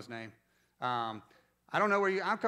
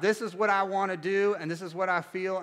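loud, raised, emphatic declaration; self-willed insistence; 4 semitones higher, 6 dB louder than usual. Retold or imitated. Preacher voicing his own wilful feelings, insistent. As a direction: insist on your own way, re-enacted.

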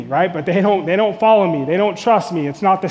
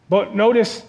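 normal talking voice

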